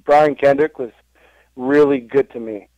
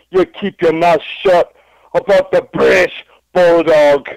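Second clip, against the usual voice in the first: higher, louder British wrestler promo voice; Davey Boy Smith promo; 6 semitones higher, 5 dB louder than usual. Pretend. Davey Boy Smith threatening Jake Roberts in a loud, high promo. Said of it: "a Davey Boy Smith promo".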